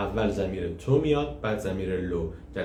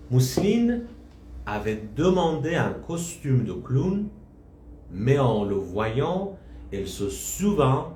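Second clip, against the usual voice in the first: slow story reading; 4 semitones higher, much slower than usual. Acted. Reads story narration slowly.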